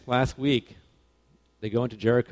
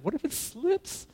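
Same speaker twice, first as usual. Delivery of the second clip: much higher, slow, timid squeak of a worried recruit; mock scared question; slower than usual. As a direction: play your younger self nervously asking. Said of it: "I said".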